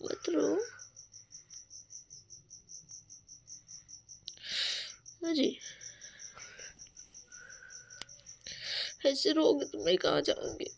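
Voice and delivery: soft, tender comforting; soothing. Real, not acted. Wife quietly soothing her crying husband, tender.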